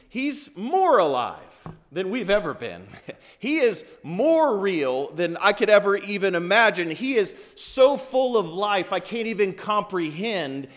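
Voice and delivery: high, forceful joyful testimony; exultant preaching. Acted. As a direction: proclaim it with overflowing wonder.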